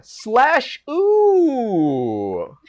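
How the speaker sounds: drawn-out ooh. Acted. Reading a winner's funny name.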